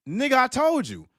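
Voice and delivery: high, smug I-told-you-so jab; mock told-you-so. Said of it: "is gonna tell you".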